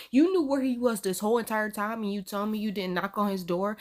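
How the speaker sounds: ordinary delivery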